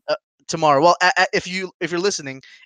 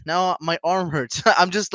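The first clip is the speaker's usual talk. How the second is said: ordinary talk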